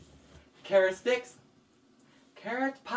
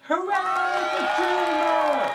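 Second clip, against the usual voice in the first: cheering crowd; 11 semitones higher than usual. Acted.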